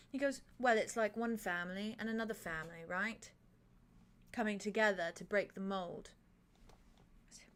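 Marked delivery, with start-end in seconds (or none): none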